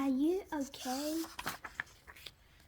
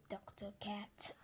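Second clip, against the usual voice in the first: whispered, much softer; 4 semitones lower, 13 dB quieter than usual. Acted. Sick Gerald answering Doctor Cat in a whisper. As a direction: whisper it, worn out.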